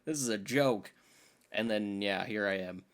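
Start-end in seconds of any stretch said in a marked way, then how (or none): none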